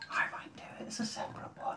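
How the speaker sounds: very soft, quick whispered aside; hushed, sneaky aside; mock-secret whisper. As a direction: whisper it so the co-host supposedly won't hear. Secret aside after co-host refuses.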